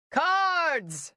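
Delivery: sudden high bellow of a name. A narrator's reading. Father summoning the butler with a sudden bellow. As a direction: roar the name across the room to summon him. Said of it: "Fairchild bellowed".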